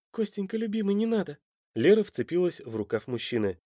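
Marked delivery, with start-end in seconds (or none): 0.1-1.4 high, small woman's voice; tender pleading. A narrator's reading. Лера begs Костя not to sacrifice himself, high and pleading. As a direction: beg your beloved, pitched well up.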